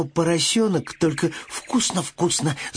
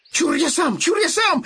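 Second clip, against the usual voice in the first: higher, excited cry of me myself; eager shout; 11 semitones higher than usual. Acted. Piglet grabs the straw from the bee, shouting. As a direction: shout it like an impatient child. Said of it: "закричал поросёнок".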